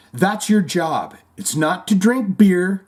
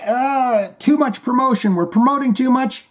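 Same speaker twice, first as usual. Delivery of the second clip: high, whiny mock complaint; mock worried musician; 9 semitones higher than usual. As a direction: fret in a high voice about too much promotion. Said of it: "So when you feel like".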